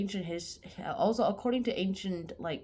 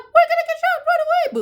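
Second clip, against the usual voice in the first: very high, loud mock kid squeal; giddy kids; 11 dB louder than usual. Kids squealing about being shot, very high and loud. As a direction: shriek it gleefully like little kids. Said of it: "the kids are like".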